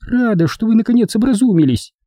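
high, haughty falsetto; mock him in a high woman's voice; high-pitched sarcastic approval; scornful snort. A narrator's reading. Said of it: "фыркнула Эдвина".

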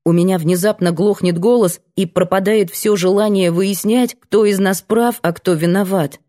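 ordinary delivery, nothing marked

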